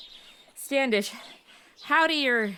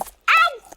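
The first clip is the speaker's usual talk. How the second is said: sudden yelp of pain; 9 dB louder than usual. Acted. Cactus prick mid-sentence.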